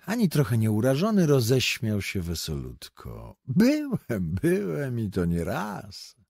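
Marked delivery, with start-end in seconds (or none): 3.5-6.2 bright, loud cheerful exclamation; exclaimed with a laugh. A narrator's reading. The stranger gaily admits a madhouse stay, exclaiming. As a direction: toss it out gleefully, amused. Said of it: "wykrzyknął ze śmiechem".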